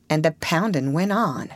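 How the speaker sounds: ordinary delivery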